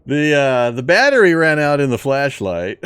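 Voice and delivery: laughing loud and high after a scare; nervous laughing. Real, not acted. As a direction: shaken laugh through the words. Startled by a game scare.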